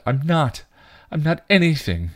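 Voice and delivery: high-pitched, upset protest; distressed denial. A narrator's reading. Dawn shakes her head at Buffy, distressed. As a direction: deny it, pitch rising in hurt. Dawn denies she is real.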